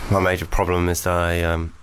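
long sigh, then low, listless voice; bored. Acted. A man forty-seven years in the same job, bored. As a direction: sound like every day is exactly the same. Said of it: "So just bored".

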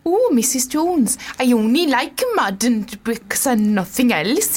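put-on slow, sing-song Welsh accent; drawn-out Welsh voice for the quote. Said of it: "It was good Welsh".

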